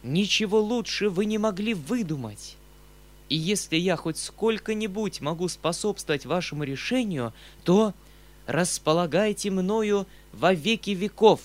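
high, rapturous pledge of support; enthusiastic, high-pitched exclamation; delighted outburst. A narrator's reading. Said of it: "закричала в восторге".

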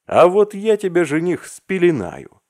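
high, loud cooing at a grown friend; mock lullaby. A narrator's reading. Arkasha rocks Vasya like an infant, cooing loudly. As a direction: coo like rocking a baby. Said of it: "приговаривал он".

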